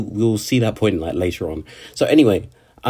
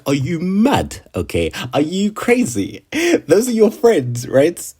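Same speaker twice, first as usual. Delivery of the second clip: incredulous, pitched-up scolding questions; mock outrage; 6 semitones higher than usual. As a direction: act baffled and indignant at her. Speaker's imagined outraged reaction.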